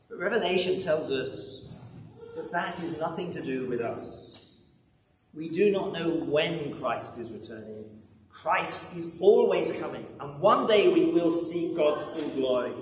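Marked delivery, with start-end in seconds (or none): none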